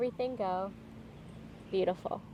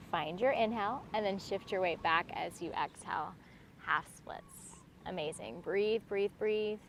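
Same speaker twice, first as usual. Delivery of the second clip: serene tai chi cues; 2 semitones lower than usual. Acted. Yoga teacher guiding calm flow.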